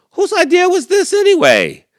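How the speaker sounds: very high, loud squeaky critter; squeaky complaint. Acted.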